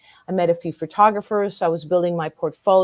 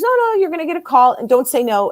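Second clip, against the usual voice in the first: insistent psychic; 7 semitones higher, 4 dB louder than usual. Retold or imitated. Retells psychic insisting she go.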